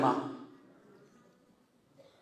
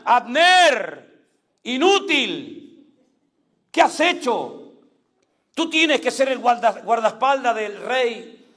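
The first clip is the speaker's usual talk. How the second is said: David calling down to Abner, higher and louder, a mild rebuke; rebuking call; 5 semitones higher, 3 dB louder than usual. Acted. David rebuking Abner from the mountaintop, calling down. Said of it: "le dijo desde lo alto de la montaña".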